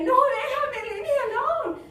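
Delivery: very high, very loud cry; panicked pleading. Acted. Drunk under attack to his attacker, panicked shouting. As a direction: yell to fend off an attacker. Victim begs attacker to stop.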